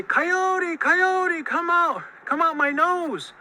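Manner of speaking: high, loud pleading calls; begging. A narrator's reading. Monster begs Coyote to come out, high pleading. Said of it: "kept begging Coyote to come out".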